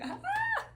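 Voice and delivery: very high, loud laugh; sudden laugh. Real, not acted. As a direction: burst out in a high-pitched laugh. Breaks off mid-story with high outburst.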